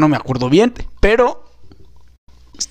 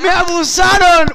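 mock outcry; 11 semitones higher than usual. Joking defense after sketch.

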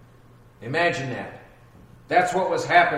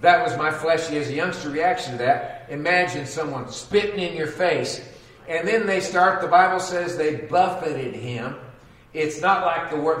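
ordinary talk, nothing marked

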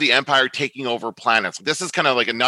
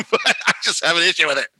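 laughing through the words, higher and quicker; 6 semitones higher, faster than usual. Real, not acted. Podcast host owning up to a pet peeve, laughing. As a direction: admit it through a real laugh. Laughing while admitting own fixation.

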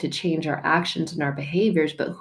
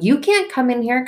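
sharp raised voice shutting someone out; angry shout; 7 semitones higher, 4 dB louder than usual. Retold or imitated. Person slamming the door on God, angry. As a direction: push him away, angry.

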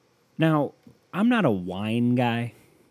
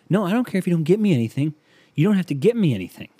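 imitating girlfriend; 8 semitones higher, 4 dB louder than usual. Girlfriend quoted insisting.

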